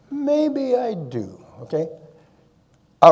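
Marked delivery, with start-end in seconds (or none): 0.1-1.4 drawn out very slowly, musing to himself; slow wondering echo. Retold or imitated. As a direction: let it sink in, slowly. His reply at the medal ceremony.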